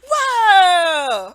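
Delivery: put-on be the dazzled kid; child's amazed whoa. Child seeing the reward star, excited whoa. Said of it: "So now they're like".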